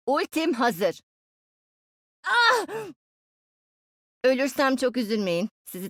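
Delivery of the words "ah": short high cry of pain. Acted. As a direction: yelp as if just hit. Oyun karakteri vuruluyor. (kısa, tiz acı çığlığı)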